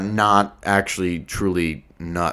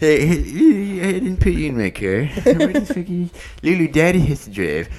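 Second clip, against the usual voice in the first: mock drunk Johnny; 7 semitones higher than usual. Continuing drunk Johnny bit.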